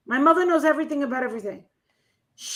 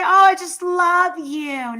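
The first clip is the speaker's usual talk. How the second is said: slow, gushing, higher fan voice; 4 semitones higher, 6 dB louder, slower than usual. Retold or imitated. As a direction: coo it like an adoring fan. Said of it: "They don't even say".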